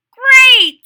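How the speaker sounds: high loud excited; Froggy cheers the outing. Acted. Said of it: "said Froggy".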